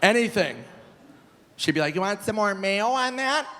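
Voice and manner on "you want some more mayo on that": high-pitched imitation of mom; lightly caricatured mother offering food; pitched-up helpful mom voice; mom impression. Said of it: "I remember my mom".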